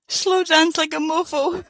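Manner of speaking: high, loud, through real laughter; laughing repeat. Real, not acted. Guest tickled by the host's slow-dance remark, laughing. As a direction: echo the phrase, cracking up.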